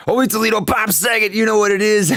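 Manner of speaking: shouted catchphrase. Acted. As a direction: yell the signature catchphrase to the chat. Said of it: "All my, all my catchphrases".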